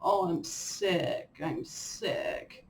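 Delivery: slow whine, trailing into a breathy whimper; mock whiny complaining. Mimicking how she complains when sick, mock whine. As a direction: whimper it like a sulky patient. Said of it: "I'll say".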